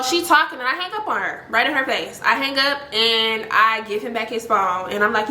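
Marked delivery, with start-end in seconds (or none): none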